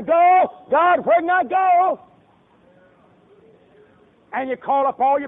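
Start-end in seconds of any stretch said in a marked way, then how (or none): 0.0-2.0 high, loud, slow frantic cry; panicked shout to God. Retold or imitated. Fleeing preacher cries out loud to God in panic. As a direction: yell it like running from a giant.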